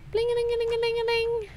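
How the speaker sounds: twinkly magic sparkle. Pretend. Singsong jingle sound while playing.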